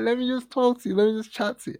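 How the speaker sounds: high-pitched coaxing from far off; retold calling out. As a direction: imitate an insistent high call.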